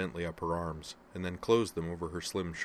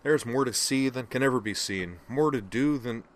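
enthusiastic, louder and higher; grand, pitched-up recital of a movie line; quoting The Lion King; 6 semitones higher, 6 dB louder than usual. Acted. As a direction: lift the quoted lyric with gusto.

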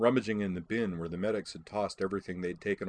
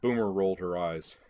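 usual speaking voice

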